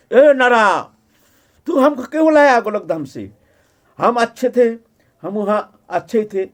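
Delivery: high, loud acted outburst opening on a shout; enraged rebuke. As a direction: play an angry master confronting the one who summoned him. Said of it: "दांत एकदम किरमिर किरमिर करते".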